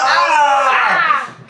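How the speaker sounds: high, loud excited yelp; excited shout. Real, not acted. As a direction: burst out with excitement. Excited about the movie Spy.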